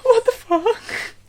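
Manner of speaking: high, slow laughing what the fuck; laughing disbelief. Real, not acted. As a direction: crack up in disbelief. Reacting to shirtless unicorn.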